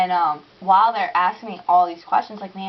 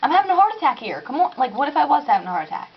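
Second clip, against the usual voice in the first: high, fast, exasperated outburst; indignant frantic rant; frantic complaint; 9 semitones higher than usual. Retold or imitated. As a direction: vent the panic at the operator. Reliving panic at operator.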